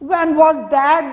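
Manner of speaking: high, loud, slow cutting question; whiplash voice. Acted. Governor lashing out at the officer, a whiplash question. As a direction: crack it like a whip. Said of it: "The governor's voice was a whiplash now".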